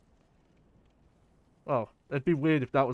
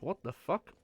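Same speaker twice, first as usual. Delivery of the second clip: ordinary talk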